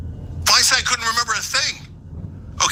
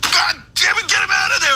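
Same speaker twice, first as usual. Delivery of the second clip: angry shout; 11 semitones higher than usual. Real, not acted. Furious rant at party leaders.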